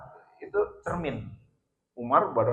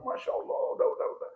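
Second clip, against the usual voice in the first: tearful whisper; 5 dB quieter, much faster than usual. Retold or imitated. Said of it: "Nangislah gitu temannya".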